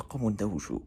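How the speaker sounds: soft breathy whisper. Real, not acted. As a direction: whisper so nobody wakes. Whispering while others sleep.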